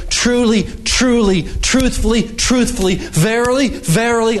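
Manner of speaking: each word high and falling, one by one; emphatic repetition. Acted. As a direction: proclaim each word as its own beat. Quoting Jesus emphatically.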